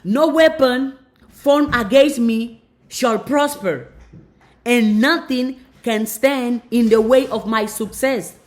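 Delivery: loud, slow, proclaiming every word; declaimed affirmation. Acted. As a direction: declare it like a triumphant decree.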